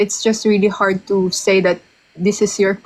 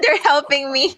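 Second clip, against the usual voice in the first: higher voice bubbling with laughter; laughing; 4 semitones higher than usual. Real, not acted. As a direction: say it through a laugh. Laughing at viewers' suggestions.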